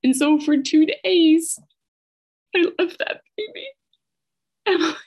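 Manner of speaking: high voice breaking into tears; real tearful breakdown. Real, not acted. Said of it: "that's so hard on me still".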